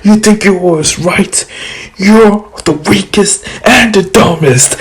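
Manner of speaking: high, mocking voice; Kurama's taunt. Kurama mocks Shukaku as weakest, high and teasing. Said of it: "Konoha's like".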